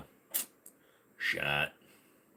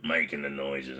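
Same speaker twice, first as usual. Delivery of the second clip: lower, softer put-on rasp; 7 semitones lower, 5 dB quieter than usual. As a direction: growl it low. Deep voice again.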